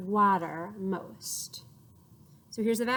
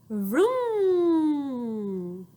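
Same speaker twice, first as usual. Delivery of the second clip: long rising vroom; 3 semitones higher, 3 dB louder than usual. Shown as a demonstration. Imitating a car for the children. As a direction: rev the engine. Engine sound.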